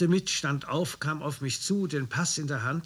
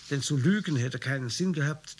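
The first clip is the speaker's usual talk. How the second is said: usual speaking voice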